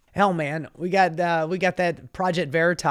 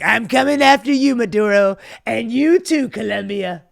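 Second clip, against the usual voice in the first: louder, higher hammed-up Trump voice; mock Trump threat; 5 semitones higher, 8 dB louder than usual. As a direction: play Trump as a blustering bully. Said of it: "that's what Donald Trump is like".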